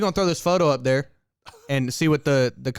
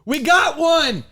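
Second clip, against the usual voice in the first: excited shout; 9 semitones higher, 4 dB louder, slower than usual. Real, not acted. Excited shout after a winning pick.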